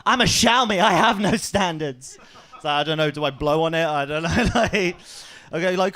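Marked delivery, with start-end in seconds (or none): 0.0-2.2 brazen phone voice. Retold or imitated. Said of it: "And it's like".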